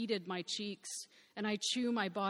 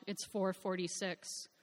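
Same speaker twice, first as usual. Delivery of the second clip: usual speaking voice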